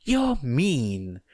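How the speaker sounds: put-on stubborn child insisting; insistent child. A narrator's reading. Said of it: "insisted Eri".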